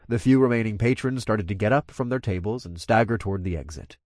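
usual speaking voice

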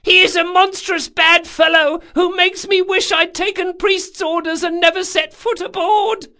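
loud, very high squeaked lament; squeaky voice. A narrator's reading. First mate posing as the imaginary sailor, squeaky. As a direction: complain in a tiny squeak turned up loud. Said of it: "in a squeaky voice".

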